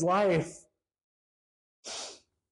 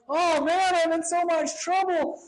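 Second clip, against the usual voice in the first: high-pitched mock despair; high, fretful lament, imitating panic; overwhelmed David; 8 semitones higher than usual. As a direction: whine it like someone swamped by trouble. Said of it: "instead of him being like".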